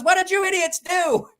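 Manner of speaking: high, mock-shrill old grandma yelling; grandmother scolding. Grandmother scolding the boys after the pitchfork accident, mock yell. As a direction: squawk it like an angry grandma. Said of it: "she goes".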